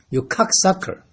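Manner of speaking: ordinary talk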